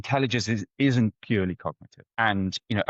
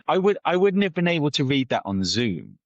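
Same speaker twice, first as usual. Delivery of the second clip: normal talking voice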